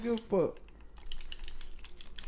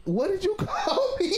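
high, laughing "what did you call me"; cracking up while asking; retelling a friend's insult, laughing; laughing disbelief. Retold or imitated. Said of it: "I dead ass was like".

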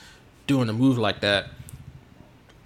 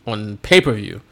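usual speaking voice

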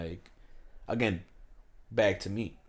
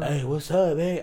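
raised, louder California street greeting; mock Cali accent; 7 semitones higher, 6 dB louder, slower than usual. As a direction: imitate Cali talk as a loud whisper. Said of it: "it's like a loud whisper".

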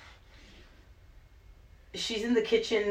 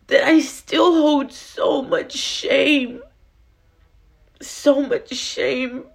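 slow, higher-pitched, tearful confession; tearful shame; 6 semitones higher, much slower than usual. Real, not acted. Speaker confesses shame over her past, tearful. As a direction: drag the words out, heavy with real emotion.

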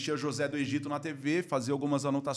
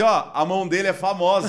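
zoação dos amigos; 5 semitones higher, 12 dB louder than usual. Retold or imitated. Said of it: "falam assim".

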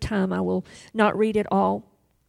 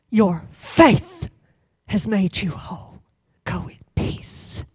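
gentle fading to a whisper; speaking peace; slower than usual. Acted. Jesus speaking peace to the woman, softening to a whisper. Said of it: "what does Jesus say to her".

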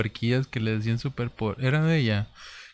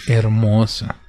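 second "hermosa" drawn out, savoring it; lightly mocking teacher gushing "hermosa"; dreamy repeat; 6 dB louder, much slower than usual. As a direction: repeat "hermosa" as if swooning over the film. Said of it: "Decía".

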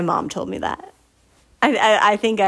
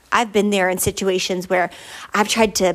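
ordinary talk

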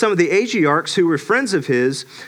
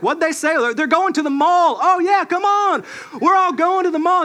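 very high, eager followers; hammed-up excited bystanders; mock clueless crowd; 10 semitones higher than usual. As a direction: jump on the bandwagon gleefully. Clueless crowd members joining in.

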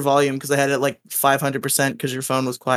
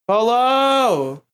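calling out; 8 semitones higher than usual. Real, not acted. Calling absent friend on call.

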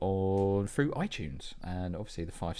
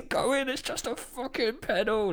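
much higher and louder, exasperated yell at the pedal; furious shout; 4 dB louder than usual. Retold or imitated. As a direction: explode at the stuck pedal.

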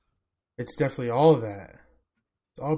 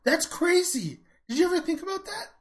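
amazed. Real, not acted. Stunned by his own idea.

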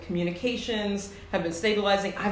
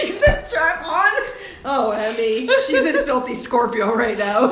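laughing; 4 semitones higher, 8 dB louder than usual. Real, not acted. Laughing at the joke.

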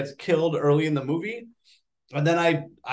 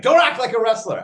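louder, higher retold scolding; mock scolding shout; 8 semitones higher, 7 dB louder than usual. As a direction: snap it like a wife losing patience. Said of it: "she's like".

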